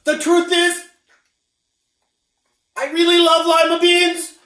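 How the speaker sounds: high loud shout to stop her; desperate cry. A narrator's reading. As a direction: call out before she leaves. Camilla confessing loudly.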